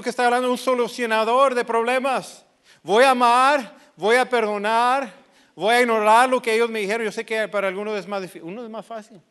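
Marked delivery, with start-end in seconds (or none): 2.8-7.0 firm declaration. Shown as a demonstration. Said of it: "declaración enfática de decisión".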